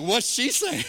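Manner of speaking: loud, laughing eager ask; laughing question. Real, not acted. Eager aside to the translator.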